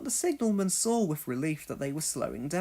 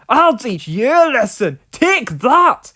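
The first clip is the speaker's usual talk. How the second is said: angry threat; 8 semitones higher, 14 dB louder than usual. Acted. Donald angrily bumps trucks.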